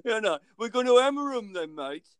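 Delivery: high, loud Dudley accent impression. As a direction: put on a Dudley voice, pitched well up. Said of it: "I can only do the Dudley one".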